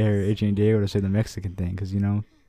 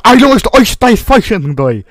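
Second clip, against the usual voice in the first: screamed much higher and louder, slow; shrieking meme yell; 11 semitones higher, 11 dB louder, slower than usual. Pretend. Friend screaming a meme line at the others, slow shriek.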